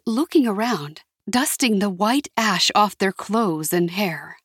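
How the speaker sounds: usual speaking voice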